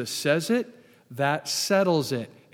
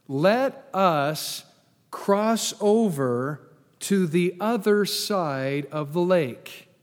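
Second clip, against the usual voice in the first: slow, deliberate scripture reading; careful, worshipful reading; much slower than usual. Shown as a demonstration. Preacher reading Jesus' words to the congregation, careful and worshipful. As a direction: give every phrase its own space, slowly. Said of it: "I'm gonna read this really carefully, really worshipfully".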